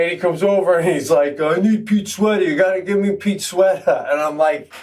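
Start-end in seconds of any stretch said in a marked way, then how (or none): 1.4-4.1 put-on high insistent drunk voice; drunk friend demanding. Drunk Lou demands sweater.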